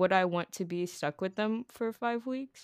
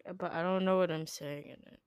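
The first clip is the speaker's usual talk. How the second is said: ordinary talk, nothing marked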